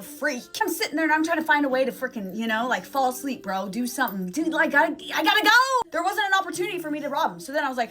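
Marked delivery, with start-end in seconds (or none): 4.3-5.9 high, loud burst of urgency; urgent outburst. Retold or imitated. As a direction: blurt it out, desperate to escape. Acting out desperation to leave.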